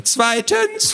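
very high, pedantic interruption; squawky insistence. Acted. Little man continues his list, squawky and insistent. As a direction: press on over the objection. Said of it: "eine quäkende Stimme".

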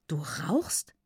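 whispered disbelief; shocked whisper. A narrator's reading. Cousin learns that the girl smokes, shocked whisper. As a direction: whisper it, aghast.

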